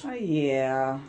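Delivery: low, drawn-out unhappy agreement. Real, not acted. Co-host reacting to the daughter being investigated, unhappy. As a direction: agree with real displeasure, pitched low. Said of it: "you don't sound very happy".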